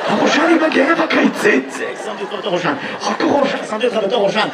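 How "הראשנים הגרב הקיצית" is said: loud, stretched-out public call; yelling at tadpoles. Acted. Water worker hailing the tadpoles, loud drawn-out call. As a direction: hail them like a lifeguard on duty. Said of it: "צעקת על ראשנים".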